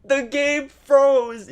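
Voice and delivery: high, loud, very slow repeat; dramatic reveal. Real, not acted. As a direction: hammer it home slowly.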